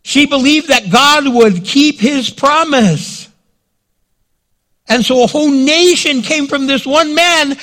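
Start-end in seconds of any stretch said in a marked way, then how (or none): none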